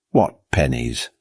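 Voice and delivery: ordinary delivery, nothing marked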